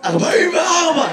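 mock hoarse reply; hoarse man imitation. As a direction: imitate a hoarse audience member. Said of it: "למה אתה נשמע ככה".

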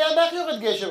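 a hint of a child's high questioning voice; imitated curious child. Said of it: "הוא אומר לי".

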